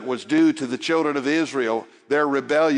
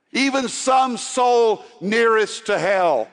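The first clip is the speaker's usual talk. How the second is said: intense plea; 9 semitones higher, 5 dB louder, slower than usual. Acted. Climax of the appeal.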